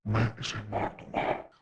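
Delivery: low, slow, hushed menace; a soft, deep, creepy threat, drawn out; scary voice. A narrator's reading. Rohit to Raj with his ear close, softly menacing. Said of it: "बड़ी डरावनी सी आवाज में".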